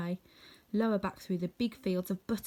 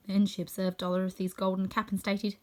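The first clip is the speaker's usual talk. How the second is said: plain reading voice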